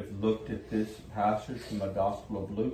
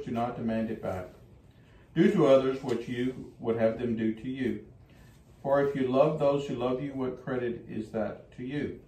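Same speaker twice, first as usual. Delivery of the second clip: gospel proclamation; 4 dB louder than usual. Acted.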